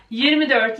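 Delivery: ordinary talk, nothing marked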